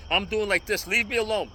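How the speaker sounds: normal talking voice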